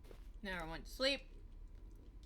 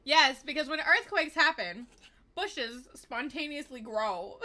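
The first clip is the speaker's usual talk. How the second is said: sarcasm; 5 semitones higher, 7 dB louder, slower than usual. Real, not acted. Sarcastic mockery of game.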